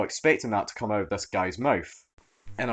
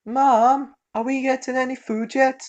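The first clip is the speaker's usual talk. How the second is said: put-on little boy voice; 7 dB louder than usual.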